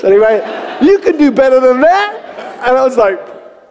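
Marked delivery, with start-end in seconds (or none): 0.8-2.6 high, forceful coaching cry; mock exhortation. Visiting father unimpressed by the attempt, urging loudly. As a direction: push him hard for more. Elder urges him on.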